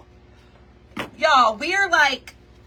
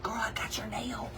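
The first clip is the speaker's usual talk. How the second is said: hushed whisper; 3 semitones lower, 13 dB quieter than usual. Retold or imitated. Friend whispering that she found the nail. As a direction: whisper it like a secret. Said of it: "she's like".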